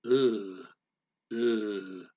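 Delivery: Ugh dropped low in the voice. Shown as a demonstration. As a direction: grunt Ugh down low, displeased. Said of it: "that's kinda low".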